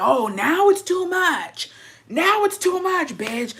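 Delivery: high, drawn-out sarcastic indignation; mocking disbelief. Husband mocking wife's protest at the orgy party, high sarcastic disbelief. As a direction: throw the words back in mock outrage.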